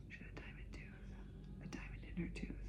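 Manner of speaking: hushed confidential aside; amazed whisper. Real, not acted. Telling viewers about the woman's diamond tooth, whispered. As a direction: whisper it like a secret.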